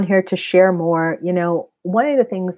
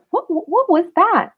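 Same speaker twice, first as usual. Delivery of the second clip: much higher, slow startled question; 11 semitones higher, slower than usual. Retold or imitated. Waking drenched beside her partner, startled and high. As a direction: gasp it out, high.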